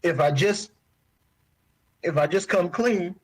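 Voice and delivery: breaking off between words, fighting tears; holding back tears. Real, not acted. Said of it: "I'm sorry".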